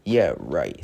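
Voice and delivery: low, lightly voiced grumpy engine; grumpy Diesel. Grumpy engine brushing off the cheering up, grumpy and low. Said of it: "said Diesel".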